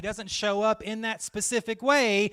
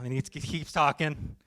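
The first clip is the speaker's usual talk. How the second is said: ordinary delivery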